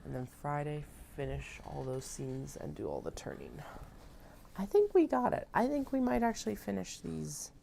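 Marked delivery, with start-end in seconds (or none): 4.6-7.5 high, loud and thrilled; excited. Real, not acted. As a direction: burst out with delight. Excited realization.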